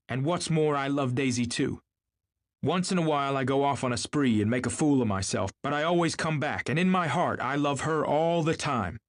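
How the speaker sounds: high, emphatic declaration; impassioned. A narrator's reading. Tom declaring his love for Daisy, impassioned. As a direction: insist with heat, pitched well up throughout.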